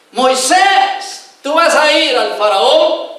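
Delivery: God summoning Moses with authority; God calling Moses, slow and commanding. Acted.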